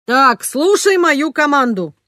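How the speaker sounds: barked mock order; commanding shout. A narrator's reading. Father taking charge of the family, commanding shout. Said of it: "крикнул папа".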